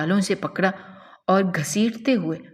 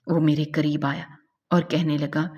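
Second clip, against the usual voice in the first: ordinary delivery, nothing marked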